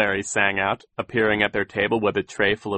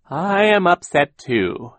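slow, high, loud show of grief; mournful voice; 9 semitones higher, 8 dB louder, slower than usual. A narrator's reading. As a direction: lament it slowly, laying the sorrow on thick. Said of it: "began to speak in a mournful voice".

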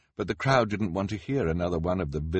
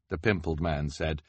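plain reading voice

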